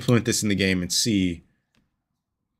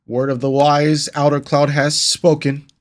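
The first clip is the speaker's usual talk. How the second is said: slow, loud, higher grand announcement; mock solemn proclamation; 5 semitones higher, 6 dB louder than usual. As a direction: proclaim it like a herald.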